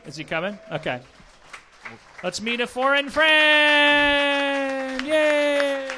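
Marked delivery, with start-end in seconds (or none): 2.2-5.9 high, drawn-out shouted fanfare; announcer shout. Pretend. Host welcomes a foreign guest, long high shout. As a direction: belt it out and stretch the last word.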